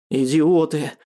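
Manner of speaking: plain reading voice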